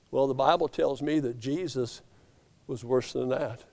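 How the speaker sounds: ordinary delivery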